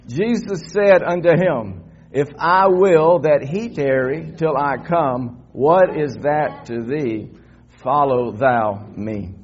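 slow, emphatic Bible reading; congregational scripture reading. Real, not acted. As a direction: read it out slowly for all to follow.

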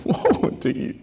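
soft, crying plea, briefly mimicked; imitated weeping. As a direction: act it out as if weeping face-down. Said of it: "weeping and crying, saying".

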